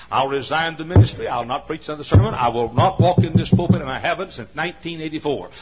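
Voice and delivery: rising to a high shouted cry; full-force preaching. Acted. Preacher vowing to his congregation to pray, shouting sermon. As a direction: proclaim it like a vow.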